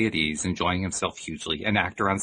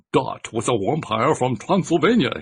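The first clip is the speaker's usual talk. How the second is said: put-on slow, heavy Transylvanian vampire accent, higher; thick Transylvanian accent; 5 semitones higher, 5 dB louder than usual. A narrator's reading. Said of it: "in words thicker than the creature's own".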